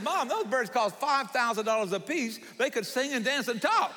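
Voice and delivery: high-pitched protesting son, hammed up; exasperated disbelief. Son tells mother what the birds cost, exasperated disbelief. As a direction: plead the case in comic dismay.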